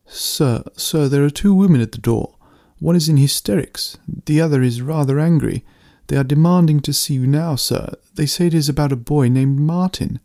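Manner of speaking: flustered servant at the door; servant announcing visitors. Acted. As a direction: report urgently but deferentially to the master.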